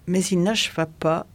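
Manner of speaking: ordinary delivery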